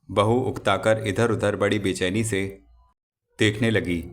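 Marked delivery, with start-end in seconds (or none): none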